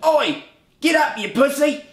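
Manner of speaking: high, loud mock British holler; stupid British accent. Injured man mimics Dickie jeering from above, mocking British yell. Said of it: "in that stupid goddamn British accent".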